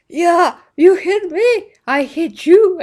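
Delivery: high, very loud mock orc yell; brawling orc. Orc answering the one he hit, loud comic bellowing. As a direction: boast back in a hammed-up orc shout. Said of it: "And the other orc is like".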